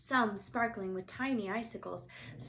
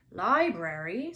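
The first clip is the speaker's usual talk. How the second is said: Hagrid puzzled; 8 dB louder, slower than usual. A narrator's reading. Hagrid voiced in story.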